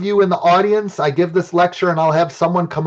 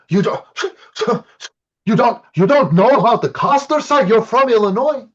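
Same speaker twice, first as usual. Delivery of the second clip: put-on higher, incredulous French-accented professor; disgusted French accent; 5 semitones higher than usual. Professor in French accent scolding his Illinois student, disgusted. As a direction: scoff at him in a French accent. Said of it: "the disgust on his face".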